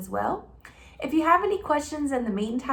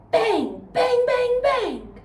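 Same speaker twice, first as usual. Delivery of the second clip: gunshot bangs; 13 semitones higher, 6 dB louder, much slower than usual. Acted. Mimicking gunshots in story.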